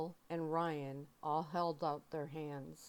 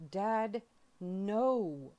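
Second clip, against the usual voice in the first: loud, high yell; 4 semitones higher, 8 dB louder than usual. A narrator's reading. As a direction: yell it out in protest. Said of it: "I yelled".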